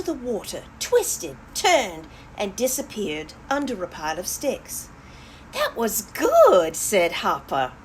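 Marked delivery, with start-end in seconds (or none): 5.5-6.7 slow, loud, high admiring hare; impressed. Acted. Hopper watching the beaver, a slow, loud, impressed exclamation. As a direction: marvel at the beaver's trick, louder and higher.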